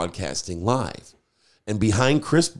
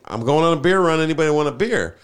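raised, higher call to a group; 7 semitones higher, 5 dB louder than usual. Retold or imitated. DJ to the band on a beer run, calling out. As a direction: re-enact shouting a casual offer to passers-by.

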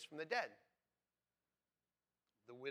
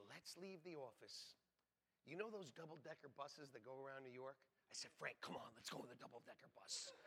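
conspiratorial hush; 4 semitones lower, 16 dB quieter than usual. Retold or imitated. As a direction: talk him into skipping work, hushed. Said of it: "And I would say".

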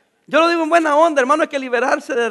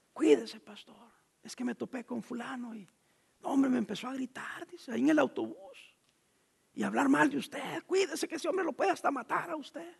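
hushed worried warning; 12 dB quieter than usual. Retold or imitated. Voicing the worried sister's warning.

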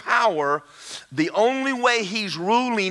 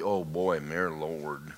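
low, soft muttering to yourself; quiet mock-dread aside; dismayed aside; 9 dB quieter, much slower than usual. Real, not acted. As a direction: murmur it under your breath, low. Muttered reaction to the verse.

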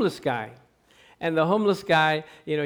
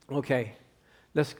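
ordinary talk, nothing marked

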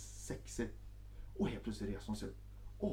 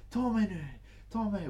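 high, louder begging; demanding lover; 10 semitones higher, 4 dB louder than usual. Retold or imitated. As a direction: urge him on. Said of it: "Hon bara". (hög, krävande röst)

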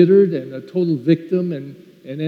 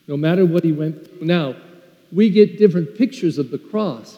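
ordinary talk, nothing marked

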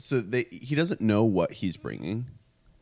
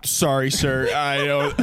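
higher-pitched, polite brush-off; mock apologetic reply; 5 semitones higher, 3 dB louder, slower than usual. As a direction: act out an uneasy apology to a stranger. Acting out his nervous reply.

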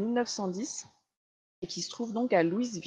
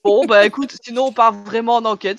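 much louder, bursting with amusement; amused loud outburst; 2 semitones higher, 13 dB louder than usual. Real, not acted. Teasing co-host about quitting the game, loud and amused. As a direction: laugh it off loudly, teasing her co-host.

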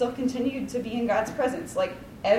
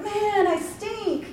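mock self-pity; 10 semitones higher, 3 dB louder, much slower than usual. Mock self-pity after distraction.

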